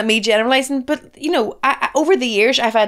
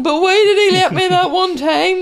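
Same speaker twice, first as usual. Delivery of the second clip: put-on child's whine; 8 semitones higher, 4 dB louder than usual. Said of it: "you had her ... times going".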